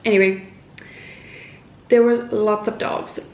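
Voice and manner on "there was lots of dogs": ordinary talk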